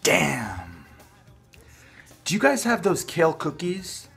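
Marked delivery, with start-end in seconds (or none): none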